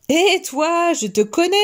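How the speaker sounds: put-on high-pitched little girl's challenge; mild cry out. A narrator's reading. Little girl recognising the wolf, crying out mildly. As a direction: call out accusingly as a little girl. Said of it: "cria la petite fille".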